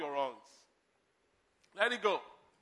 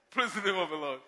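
ordinary talk

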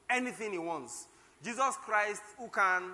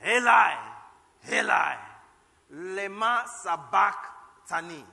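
loud cry; 8 dB louder, slower than usual. Acted. Jesus on the cross crying out to God, loud and slow. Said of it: "called out with a loud voice".

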